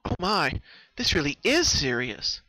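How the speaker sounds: suddenly grasping how bad it is; alarmed realisation. Twilight realising Pinkie is truly unwell, loud alarmed voice.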